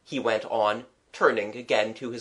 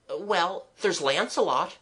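put-on higher, lilting child's voice; lightly voiced child offering examples; earnest child; 7 semitones higher than usual. Child naming heroes to the artist, earnest.

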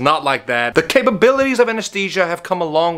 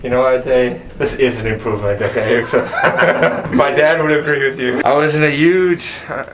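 muffled behind surgical mask; talking with jaw bandaged; through a face mask; 3 semitones lower than usual. Real, not acted. Seen 0: Blue surgical mask covers his mouth throughout; jaw also wrapped.

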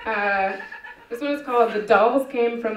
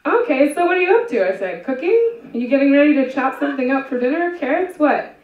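much higher, eager rapid-fire questions; high, eager questioning; peppy questions; 5 semitones higher, 5 dB louder than usual. Acted. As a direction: pester the silent caller brightly. Peppy questions to menacing caller.

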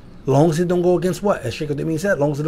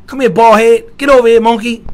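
loud, high, rough-loving teasing; affectionate harshness, acted out; mock harsh; 8 semitones higher, 8 dB louder than usual. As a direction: bark playful insults at family. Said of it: "the more harsh we talk to one another".

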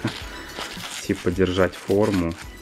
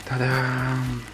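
high-pitched ta-da; ta-da reveal; 6 semitones higher than usual. Real, not acted. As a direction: announce the big reveal. Unveiling the doll's hair.

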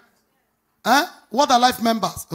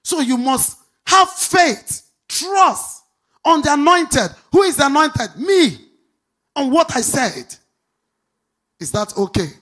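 louder and higher, slow emphatic lines; preaching at full force; 4 semitones higher, 6 dB louder, slower than usual. Acted. Pastor warning the congregation against dishonor, preaching loudly and slowly. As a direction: drive every word home. Preacher drives home points five and six.

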